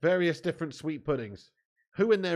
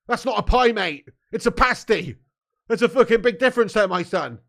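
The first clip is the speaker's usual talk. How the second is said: indignant rant; 6 semitones higher, 9 dB louder than usual. Real, not acted. Ranting at chat over pies.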